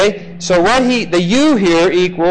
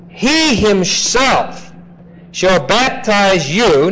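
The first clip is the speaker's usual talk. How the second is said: He himself slow, He stressed hard; great emphasis; 3 semitones higher, much slower than usual. Shown as a demonstration. Teacher stressing the verse for students, slow emphatic He. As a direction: drag out He himself with weight. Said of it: "Now, great emphasis".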